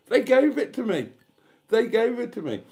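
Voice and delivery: high, loud crowing over a freebie; gleeful triumph. Real, not acted. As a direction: crow it in astonished delight. Gloating over getting it free.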